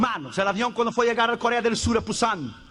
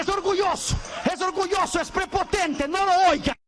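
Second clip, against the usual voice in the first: high shouted pleading with God; panicked prayer; 6 semitones higher than usual. Retold or imitated. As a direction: beg God frantically not to listen. Passenger praying in panic.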